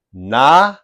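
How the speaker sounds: "knowledge" opening on a loud, rising "NAH"; combined-word pronunciation. Shown as a demonstration. Teacher shows how Americans combine the words, "knowledge" as "NAH-lidge". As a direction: say "knowledge" as "NAH-lidge", syllables held apart. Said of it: "the pronunciation becomes".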